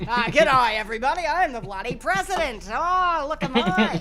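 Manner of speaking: loud hammy Australian g'day; Hugh Jackman as president. Pretend. Riffing on a podcast, Hugh Jackman as president in a loud Australian accent. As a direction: boom it out like an Aussie president. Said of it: "What if Hugh Jackman was the president?".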